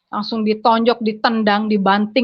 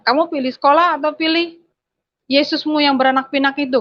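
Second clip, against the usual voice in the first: mocking father's demand; 5 semitones higher than usual. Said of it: "bapak saya tanya".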